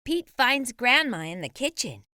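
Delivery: usual speaking voice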